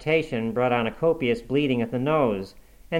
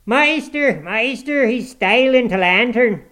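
high, loud dialect alarm call; aged servant raising the alarm; old man's shout; 11 semitones higher, 7 dB louder, slower than usual. A narrator's reading. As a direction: shout for the master to catch a thief. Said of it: "shouted the ancient".

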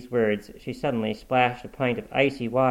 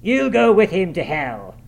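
loud, raised-pitch outburst; angry exclamation; 6 semitones higher, 6 dB louder than usual. A narrator's reading. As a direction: overrule him angrily. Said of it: "exclaimed his master".

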